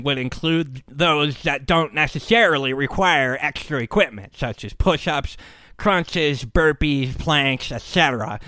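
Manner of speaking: put-on nerd voice, lecturing; know-it-all nerd. Know-it-all swimmer listing obvious exercises, nerd voice. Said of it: "I'll do the know-it-all nerd voice".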